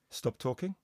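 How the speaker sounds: soft, low-volume command; hushed stop order from the booth; hushed coach. Retold or imitated. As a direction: murmur it like a voice in an earpiece. Coach whispering in his earpiece.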